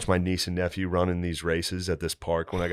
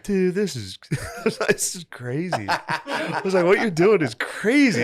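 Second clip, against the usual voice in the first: much higher, giggling through the words; laughing retelling; 9 semitones higher, slower than usual. Retold or imitated. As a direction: laugh while you quote yourself.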